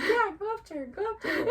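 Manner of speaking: high, urging mock cheer; imitated dad's eager nudge; encouraging dad. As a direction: egg someone on eagerly, half laughing. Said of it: "encouraging him".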